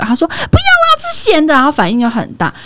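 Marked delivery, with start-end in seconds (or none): 0.3-1.5 put-on high kid voice throwing a fit; whining refusal. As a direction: refuse the fruit like a cranky boy. Mother voices son refusing fruit.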